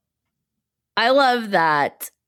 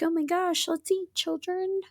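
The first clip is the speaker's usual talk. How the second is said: creepy couple voice; 12 semitones higher, 6 dB quieter than usual. Retold or imitated. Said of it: "Brad and Agatha are like".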